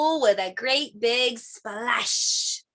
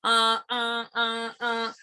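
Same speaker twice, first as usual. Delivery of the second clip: plain reading voice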